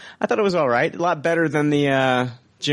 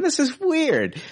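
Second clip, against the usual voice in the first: incredulous; slower than usual. Real, not acted. Incredulous about actor's hobby.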